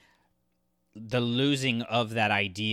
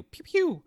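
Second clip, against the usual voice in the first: high-pitched pew pew blaster sound; playful pew pew of a toy blaster; blaster noise; 5 dB louder than usual. Retold or imitated. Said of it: "And she's like".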